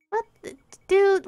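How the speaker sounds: high, loud exasperated dude; exasperated outburst. Acted. Friend blurts out after a grim guess, exasperated and loud. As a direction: throw up your hands at your friend.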